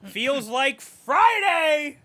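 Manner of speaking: mock review reading.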